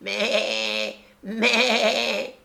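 high quavering goat bleat; shaky vibrating voice. Acted. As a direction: bleat like a timid kid goat. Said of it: "se mit à bêler d'une voix chevrotante".

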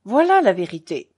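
loud, dry verdict; sharp accusing conclusion; curt. A narrator's reading. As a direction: state it curtly and forcefully. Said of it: "dit-il sèchement".

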